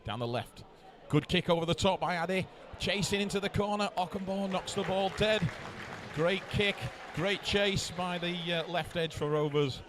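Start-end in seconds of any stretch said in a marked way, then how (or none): 1.1-9.8 higher, building play-by-play; excited commentary. Real, not acted. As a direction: call the chase with rising excitement. Exciting kick chase.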